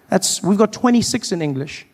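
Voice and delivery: ordinary talk, nothing marked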